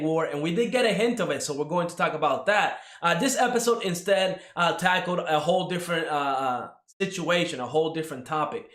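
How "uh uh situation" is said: ordinary delivery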